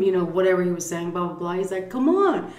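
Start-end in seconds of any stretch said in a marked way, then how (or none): 1.9-2.5 high goading come on; goading call. Retold or imitated. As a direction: egg them on, pitched way up. Aaron goading the men.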